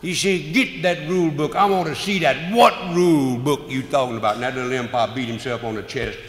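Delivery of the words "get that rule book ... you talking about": angry demand. Retold or imitated. Manager demanding the rule book.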